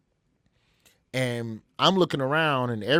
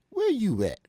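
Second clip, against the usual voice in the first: [name]; 11 semitones higher, 4 dB louder than usual. Retold or imitated. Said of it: "he speaks real slow".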